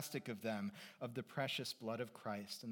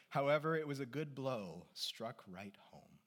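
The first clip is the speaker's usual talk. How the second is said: usual speaking voice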